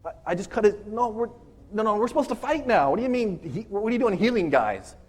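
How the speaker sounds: flabbergasted Peter. Retold or imitated. Peter reacts to Jesus healing the enemy, flabbergasted. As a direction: imitate a stunned follower, objecting harder and harder. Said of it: "Peter's more flabbergasted".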